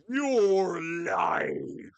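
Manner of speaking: loud booming threat; menacing ghost boom. Acted.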